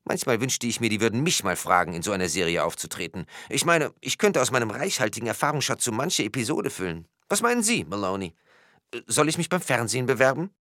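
high-pitched, musing character voice; wistful policeman. A narrator's reading. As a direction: daydream aloud in a high policeman's voice. Policeman's wistful daydream in story.

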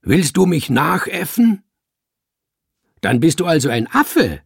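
loud, threatening outburst; thundering. A narrator's reading. Man mocked by hidden speaker, thundering. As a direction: thunder the warning angrily. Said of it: "donnerte er".

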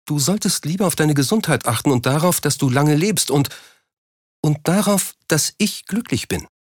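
pleading wife. A narrator's reading.

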